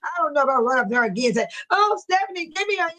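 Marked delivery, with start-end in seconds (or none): 1.7-2.9 higher pleading fan voice, pestering; mock pleading. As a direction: beg for the interview, hammed up. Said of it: "I'm not gonna keep that up".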